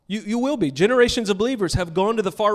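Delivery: a preacher lifting the crowd, pushing "will" up and out; rising preacher's call. Real, not acted.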